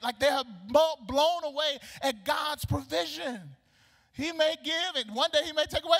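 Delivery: high, loud celebratory preacher voice; exuberant preaching. Real, not acted. Preacher celebrating God's provision, exuberant. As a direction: preach it at full force, high and excited.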